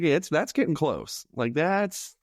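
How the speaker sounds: ordinary delivery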